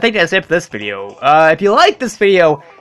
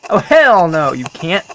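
ordinary talk

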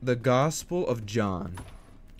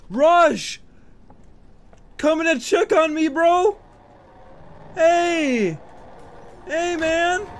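high, loud yelling; startled shout; 6 dB louder, slower than usual. Real, not acted. Player yelling at Rush in the game, high shouting. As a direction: yell at the monster charging in.

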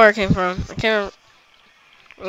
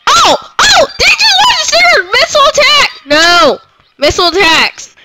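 panicked shrieks; 10 semitones higher than usual. Real, not acted. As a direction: shriek as everything goes wrong. Panic as missile attack hits.

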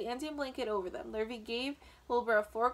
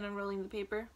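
usual speaking voice

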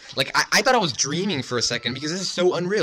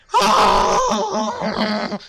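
high squealing laugh-scream; overjoyed screaming laughter; shrieking laugh; 5 dB louder than usual. Real, not acted. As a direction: let out a wild shriek of joy. Overwhelmed after legendary pull.

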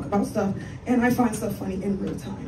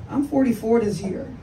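ordinary talk

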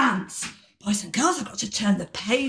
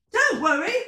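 higher-pitched reassuring call; mild acted shout; 6 semitones higher, faster than usual. Callie calling to Marvin in the tree, mild shout. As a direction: call out lightly in a raised pitch. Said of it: "shouted Callie".

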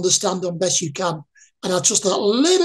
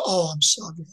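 mock pity, softer and lower, head dropping; pitying sorry; 5 semitones lower, 6 dB quieter than usual.

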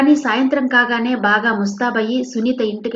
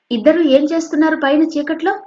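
high, annoyed mother question; mild angry demand; somewhat angry; 7 semitones higher, 4 dB louder than usual. Acted. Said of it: "కాస్త కోపంగా అంది".

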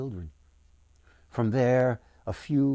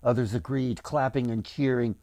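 ordinary delivery, nothing marked

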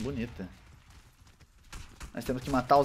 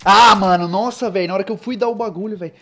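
frustrated shout; 6 semitones higher, 12 dB louder than usual. Real, not acted. Killed just before stabbing enemy.

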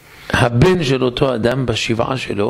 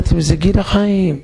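higher and slower, savouring the toast; joyful toast repeated; 6 semitones higher than usual. Retold or imitated. Husband repeats to doubtful wife, elated and slow. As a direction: insist happily on the drink.

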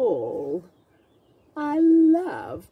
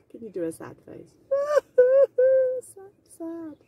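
plain reading voice

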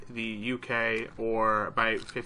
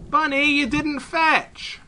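high, slow, loud playful whine; drawn-out, hammed-up disappointment; mock complaint; 13 semitones higher, 7 dB louder, slower than usual. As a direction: scold a pet in mock dismay. Mock complaint to a game rabbit.

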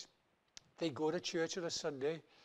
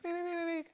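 high squeaky monkey wees; monkey squeak; 11 semitones higher, faster than usual. Retold or imitated. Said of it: "a monkey kind of squeak".

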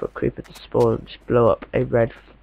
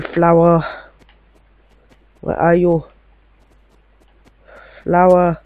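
playful calling out; 3 semitones higher, 5 dB louder, slower than usual. Pretend. Player calling to the missing red flower, playful searching call. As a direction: pretend search call, a bit louder.

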